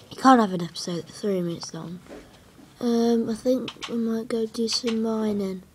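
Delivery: ordinary talk, nothing marked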